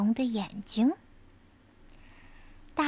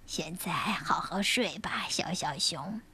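low, soft goodnight whisper; soothing whisper; 5 semitones lower, 6 dB quieter than usual. Acted. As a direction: lull a child to sleep. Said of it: "大大熊说完". (温柔的晚安低语)

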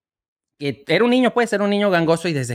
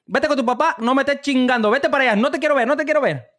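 mock annoyed mom; 7 semitones higher than usual. Mother brushing off son in joke.

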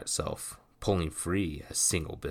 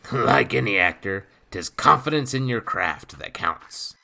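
lightly voiced gruff pirate, a touch louder; rough pirate voice; 6 semitones higher, 8 dB louder than usual. A narrator's reading. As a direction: speak like a swaggering old sea rogue. Nathan voiced.

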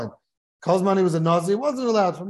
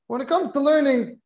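preaching; 5 semitones higher than usual. Acted. Preacher drives the moral home.

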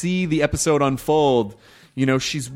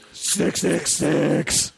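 slow drawn-out devil intro; 3 semitones higher, much slower than usual. Acted. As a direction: intone it like a demon. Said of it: "[name]'s got a nice devil voice".

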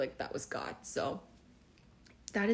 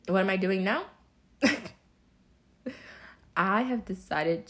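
excited; 7 dB louder than usual. Real, not acted.

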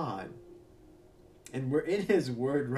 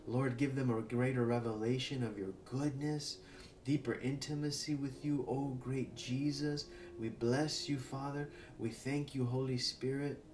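soft prayer; 4 semitones lower, 8 dB quieter than usual. Acted. Soft prayer over listeners.

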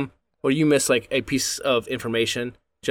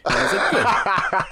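laughing; 9 semitones higher, much slower than usual. Real, not acted. Laughing while retelling.

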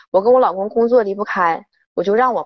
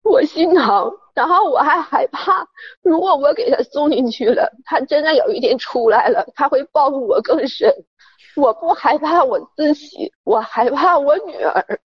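frightened; 6 semitones higher than usual. Real, not acted.

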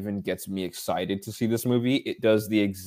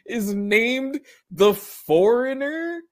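very high, slow, drawn-out disbelief; incredulous; 12 semitones higher, slower than usual. Real, not acted. Repeating the villain's name to cohosts, utterly incredulous. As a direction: stretch out the question, voice climbing in amazement.

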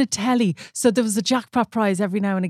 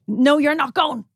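higher, firm mock refusal; dad refusing; 7 semitones higher, 4 dB louder, slower than usual. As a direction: act out dad laying down the law. Dad refusing the child's plea.

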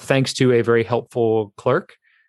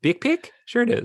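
high mock New Zealand accent, big pick pitched way up; 11 semitones higher than usual. Insisting to co-host in a high mock New Zealand accent. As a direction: push the Kiwi vowels up high. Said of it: "that's more New Zealand".

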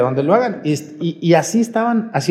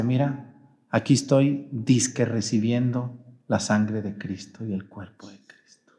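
softer, sneering, fading into a whisper; mocking; 7 semitones lower, 9 dB quieter than usual. Podcaster mocking his first communion photo, sneering whisper. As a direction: sneer at the old photo under your breath. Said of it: "burlándose de cuando hizo su primera comunión".

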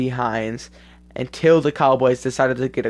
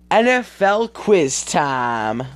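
slow, drawn-out game show announce; quiz show announcer; 4 semitones higher, 4 dB louder, much slower than usual. Acted. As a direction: stretch it out like a quiz host.

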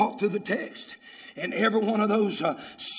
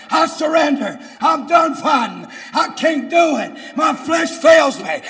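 shouted sermon, building higher and louder; full-force preaching; 7 semitones higher, 11 dB louder than usual. Real, not acted. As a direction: cry surrender out to God.

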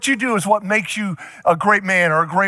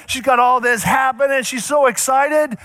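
ordinary talk

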